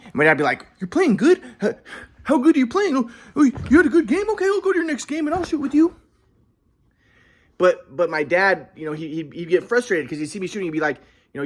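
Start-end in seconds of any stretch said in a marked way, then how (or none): 0.8-6.0 high, sarcastic dad voice; mock the son's claim. Son imitates dad doubting his good games, high and sarcastic.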